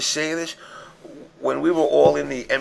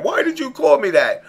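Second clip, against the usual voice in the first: high-pitched mock-tearful complaint; mock crying; 8 semitones higher, 4 dB louder than usual. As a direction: wail it like a hurt crybaby, hammed up. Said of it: "call me up tomorrow crying".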